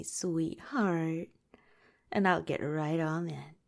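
ordinary talk

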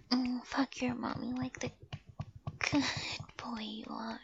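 ordinary delivery

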